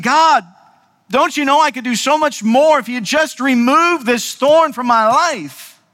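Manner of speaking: pleading prayer, slowing at the end; frustrated plea. Acted. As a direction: demand it of God, frustrated. Paul's frustrated prayer acted out.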